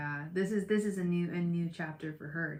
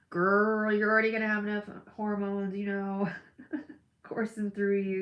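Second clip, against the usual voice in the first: emphatic; 3 semitones higher, 8 dB louder than usual. Real, not acted. Telling Jessica loudly not to worry. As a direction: hit it loud and sassy.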